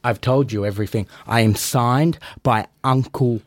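usual speaking voice

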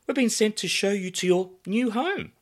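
high, bright welcoming hostess; glamorous hostess voice. Acted. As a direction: greet guests like a bombshell hostess.

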